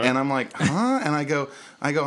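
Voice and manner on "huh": high, incredulous huh; a startled, high-pitched huh; baffled huh. Retold or imitated. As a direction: react in total disbelief. Baffled reaction to drunk customer.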